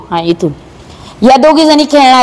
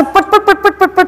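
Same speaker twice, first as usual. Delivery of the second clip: quick, higher-pitched patter of पटपट; rapid-fire repetition; 6 semitones higher, 3 dB quieter than usual. Shown as a demonstration. Teacher showing how fast sums will come, rapid-fire. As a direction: rattle off पटपट in a quick high run. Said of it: "पटपट आपल्याला बेरजा करता येणार".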